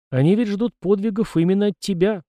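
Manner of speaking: usual speaking voice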